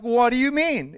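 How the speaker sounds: ordinary talk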